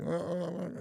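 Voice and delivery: high, panicked mock yelps; scared yelps. Frightened bloke yelping at John's challenge, high mock panic. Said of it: "And old mate".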